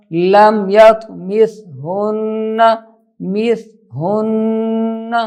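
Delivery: slow tajweed with held n; Quran recitation. Shown as a demonstration. Teacher demonstrating a phrase, slow recitation. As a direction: linger on the doubled n.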